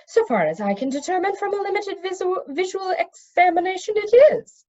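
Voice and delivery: high, careful scholarly magician voice; precise magician. A narrator's reading. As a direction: lecture it like a fussy expert. Telemain figure voice.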